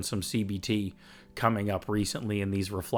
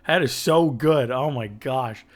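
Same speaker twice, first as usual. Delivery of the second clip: higher and louder, slow and gushing; fired up; 4 semitones higher, 6 dB louder, slower than usual. Real, not acted. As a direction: gush with real excitement. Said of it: "that gets me right fired up".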